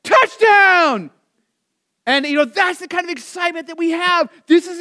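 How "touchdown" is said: loud, high "Touchdown!" cheer; excited touchdown shout. Retold or imitated. Pastor imitating kids cheering at football, loud and high. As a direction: yell it like a kid with arms up. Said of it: "throw their arms up in the air and say".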